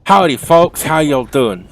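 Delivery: loud, high, hammed-up Southern cowboy greeting; host greets the crew in a terrible Southern accent. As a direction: belt out a bad Southern accent. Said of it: "That was terrible. Was that a Southerner?".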